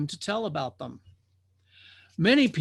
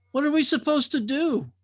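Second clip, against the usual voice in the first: worried drifter; 10 semitones higher than usual. Acted. Frightened drifter asks.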